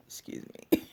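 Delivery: normal talking voice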